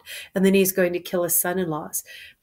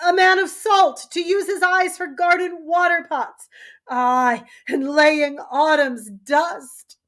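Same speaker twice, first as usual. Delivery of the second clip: high, loud, teary then laughing; a weeping king who forces bravado; mad king crying then jovial; 10 semitones higher, 7 dB louder than usual. Acted. Lear pleads then turns jovial.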